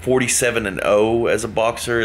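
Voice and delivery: ordinary talk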